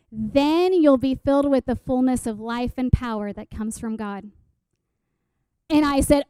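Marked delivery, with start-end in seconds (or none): 0.1-4.3 emphatic, declarative Scripture recital; verse recitation. Acted. Speaker quotes the memorized promise to listeners, emphatic recitation. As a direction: launch firmly, then settle lower at the end. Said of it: "in the translation I memorized it".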